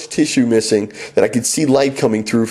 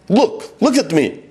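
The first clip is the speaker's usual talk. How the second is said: slow, high foreign-student command; urgent broken-English order; 8 semitones higher than usual. Retold or imitated. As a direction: insist he watch, slowly. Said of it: "he commanded".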